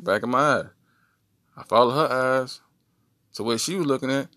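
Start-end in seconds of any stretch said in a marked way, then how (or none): none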